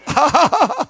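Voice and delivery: high, short burst of laughter; amused laugh. Real, not acted. Pastor finding the forgetful deacon endearing, amused high laugh.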